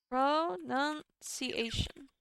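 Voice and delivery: "Pronunciation" very slowly, syllable by syllable; drawn-out syllables. Shown as a demonstration. Looking up how to say it, "Pronunciation" drawn out slowly. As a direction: stretch out each syllable of "Pronunciation".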